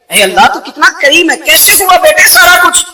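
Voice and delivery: loud, high, tearful-laughing joy; overjoyed cry. Retold or imitated. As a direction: cry out in amazed gratitude. Parents overjoyed, daughter healed. (خوشی سے روتے ہوئے اونچی پکار)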